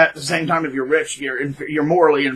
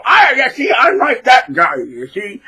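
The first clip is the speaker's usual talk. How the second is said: much higher and louder, slowed smug gloating; mock smug narc; 7 semitones higher, 4 dB louder, slower than usual. Narc gloating at the TV about his hero, mock smug. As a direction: ham up a self-satisfied poser. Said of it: "watch T V and go".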